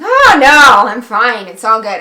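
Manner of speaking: very loud, overdone "oh, no" easing off; fake fine. Answering a caller who asks if they're mad, exaggerated fake cheer. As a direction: insist all is well while obviously mad. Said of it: "I was so mad".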